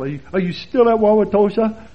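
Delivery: mock incredulous question leaning on STILL; emphasis on STILL. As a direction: hit "still" hard, mimicking the askers. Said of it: "the emphasis on still".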